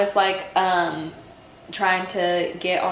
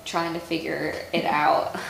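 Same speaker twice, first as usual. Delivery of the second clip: ordinary delivery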